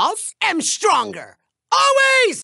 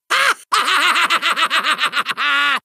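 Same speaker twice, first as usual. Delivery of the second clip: booming villain cackle; evil cackle; 4 semitones lower than usual. Acted. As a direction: maniacal gloating laugh. Challenges to evil off.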